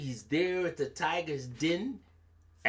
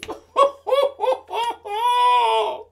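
high loud hammed-up wail; fake wail; 8 dB louder than usual. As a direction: wail as if heartbroken. Anansi fake weeping.

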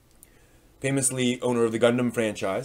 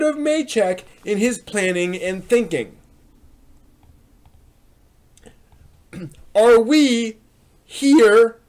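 lively ranting reading voice, a bit higher and louder; animated post reading; 9 semitones higher, 7 dB louder than usual. Acted. Reading a fan's forum complaint aloud, indignant. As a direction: read the rant like an indignant fan.

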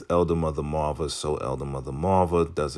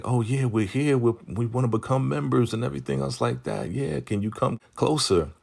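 high-pitched put-on friendly chatter; imitated eager couple; 7 semitones higher than usual. Couple asking Joan to come closer, high imitated eagerness. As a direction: pitch it up as keen would-be members.

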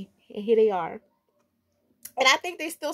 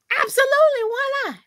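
high, loud, gleeful comeback; defiant exclamation; 13 semitones higher, 7 dB louder than usual. Real, not acted. Vlogger answering imagined critics of her shoes, loud defiant cry. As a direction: belt it out at the doubters.

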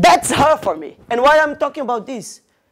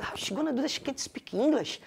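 hushed, under-the-breath aside; soft retold private doubt; doubtful inner thought; 14 dB quieter than usual. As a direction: mutter it privately, very softly. Retold private doubt about her.

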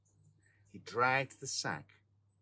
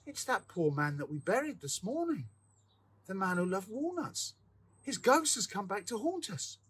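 frightened cry; 8 semitones higher than usual. A narrator's reading. Terrified sexton reports ghost.

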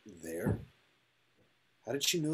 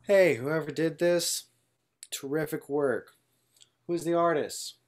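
loud call to the crowd; 4 semitones higher, 6 dB louder than usual. Acted. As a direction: shout it across the field. Calling out to the crowd.

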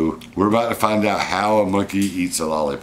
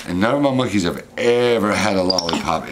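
ordinary talk, nothing marked

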